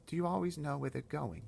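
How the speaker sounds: put-on a small girl pleading to stop the giants; high girl's voice. Sophie voiced in story.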